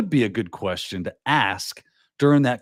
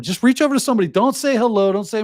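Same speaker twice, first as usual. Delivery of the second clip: higher, louder pushy advice; energetic coach urging, pitched up; excited urging; 9 semitones higher, 4 dB louder, faster than usual. Real, not acted. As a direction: push them to just go for it. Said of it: "urging advice, raised voice".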